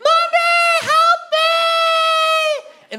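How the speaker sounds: put-on high, loud, drawn-out child's wail for help; hammed-up shouting. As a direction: scream it long and high, faking distress. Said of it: "Shout louder, your mother can't hear you".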